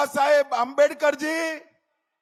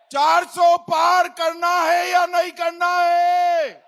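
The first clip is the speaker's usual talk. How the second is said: shouted questions to a rally crowd; roaring rallying speech; 4 semitones higher, 4 dB louder than usual. Acted. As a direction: rouse the crowd with loud questions. Rally crowd call and response.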